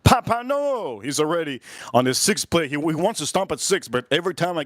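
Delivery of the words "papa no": put-on higher, pleading kid voice; friend's plea. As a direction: plead with papa in a raised pitch. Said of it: "my best friend's like".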